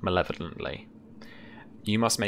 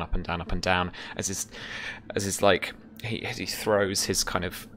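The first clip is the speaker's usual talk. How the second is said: ordinary talk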